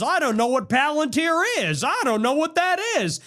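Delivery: a hint of a sly politician feigning ignorance; mock J D Vance. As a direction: play dumb in a lightly voiced mock Vance. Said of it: "J D Vance goes on Theo Von and says".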